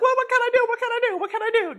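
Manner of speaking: high, loud panicky repeated plea; mock helpless man. Man reacting to a crying partner, high panicked plea. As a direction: flail like a man who can't fix it. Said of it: "they're like".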